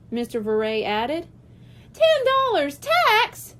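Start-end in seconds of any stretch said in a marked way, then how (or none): 1.9-3.6 put-on high, slow, louder child's outrage; high indignant child's voice; shocked kid. A narrator's reading. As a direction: gasp out the price like a stunned kid.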